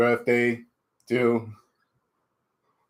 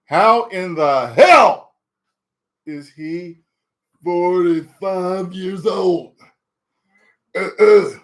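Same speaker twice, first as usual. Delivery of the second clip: high, slow, hammed-up disbelief; mock disbelief; 7 semitones higher, 8 dB louder, slower than usual. Streamer marvels at his own age to chat, hammed-up high disbelief. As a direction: stretch it out in fake shock.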